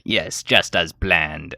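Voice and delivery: a hint of a slow, sinister emperor; sly villain. Palpatine answers Rey with slow villain glee. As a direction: drag it out like a scheming villain.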